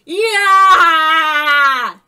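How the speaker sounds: long, high, full-volume yell; battle cry. A narrator's reading. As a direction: charge in with a drawn-out shout. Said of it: "he cried, swinging".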